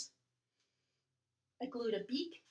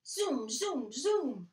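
zoom; 4 dB louder, much slower than usual. Acted. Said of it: "The kite flew".

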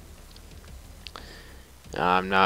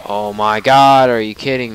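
furious shout; 3 semitones higher, 12 dB louder than usual. Real, not acted. Gamer yelling at the boss after dying, furious shout. Player dies in boss fight.

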